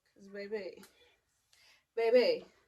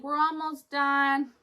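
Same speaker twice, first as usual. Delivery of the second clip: higher, slow, louder sing-song reassurance; mocking the nurse's soothing tone; 5 semitones higher, 9 dB louder, slower than usual. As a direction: mimic the nurse, drawn out. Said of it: "they're like".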